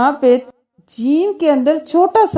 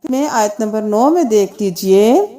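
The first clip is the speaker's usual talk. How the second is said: ordinary delivery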